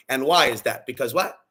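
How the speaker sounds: normal talking voice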